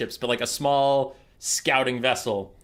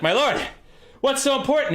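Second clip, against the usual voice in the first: eager knight; 10 semitones higher than usual. Acted. General greets his king.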